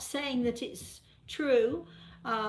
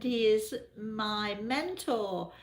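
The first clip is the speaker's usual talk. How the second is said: usual speaking voice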